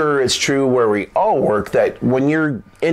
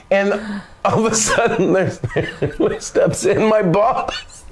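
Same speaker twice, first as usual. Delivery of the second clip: cracking up through the words, higher pitch; laughing while talking; 5 semitones higher than usual. Real, not acted. Storyteller reaching the boss walking in, helpless laughter. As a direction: struggle to tell it through real laughter.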